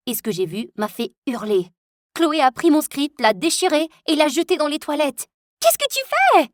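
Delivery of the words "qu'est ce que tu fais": very high, loud shocked cry; shocked outcry. Acted. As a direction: cry out in disbelief. Confronting Chloé at toilets.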